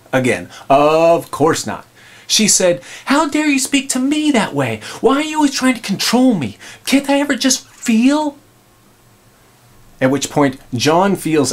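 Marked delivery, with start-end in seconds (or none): 0.7-1.8 long drawn-out OF, then dry finish; slow, amused dismissal; emphatic denial. Real, not acted. Repeated punchline denial. 3.0-8.4 acted, very high-pitched protest; high, exasperated victim voice; indignant outburst. Acting wife's self-righteous counterattack.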